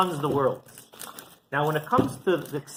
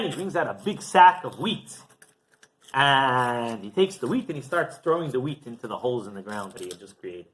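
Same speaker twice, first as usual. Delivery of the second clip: ordinary delivery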